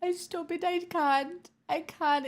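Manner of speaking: ordinary talk, nothing marked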